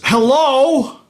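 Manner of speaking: squeaky chirped hello; high hello. Shown as a demonstration. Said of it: "many, many ways of saying hello".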